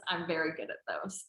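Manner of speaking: ordinary delivery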